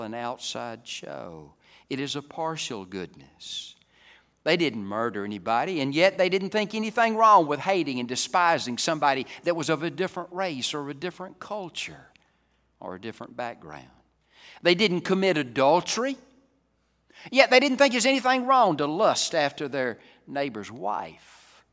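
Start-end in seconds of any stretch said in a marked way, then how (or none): 4.4-12.1 loud, raised preaching voice; forceful preaching. Real, not acted. 14.6-21.6 loud, high-pitched preacher's proclamation; raised, emphatic pulpit voice; forceful preaching. Real, not acted.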